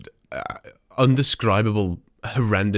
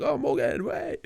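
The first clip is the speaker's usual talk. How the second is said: high, softer mock fangirl call; imitating an eager fan; 7 dB quieter, slower than usual. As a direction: squeal it softly like a starstruck fan. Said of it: "It'll be like".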